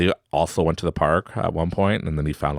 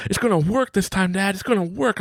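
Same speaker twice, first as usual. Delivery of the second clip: put-on higher kid voice, full of faith; hopeful son. Son assuring dad, higher hopeful child voice. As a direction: promise it like a believing child.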